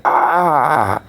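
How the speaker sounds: sharp high yelp of pain, mock; imitating a sudden jolt of pain; pained cry. Mark jolts in pain on the surgery chair, mock yelp.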